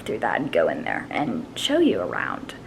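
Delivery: low whispered aside. Acted. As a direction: whisper it like a secret. Whispered aside about smell.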